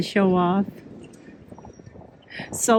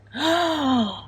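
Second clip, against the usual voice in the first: excited gasp; 7 semitones higher than usual. Real, not acted. Sees the tom display.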